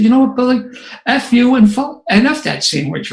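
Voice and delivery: exploding at a friend. Retold or imitated. Yells at friend on plane.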